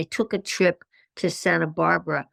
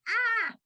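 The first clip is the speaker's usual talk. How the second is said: sudden high panicky "Ah" squeak; panicked gasp; 4 dB quieter than usual. Retold or imitated. Speaker recalls panic before first workshop, high panicked yelp. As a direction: yelp it like you're freaking out. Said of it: "my little body went into ... mode".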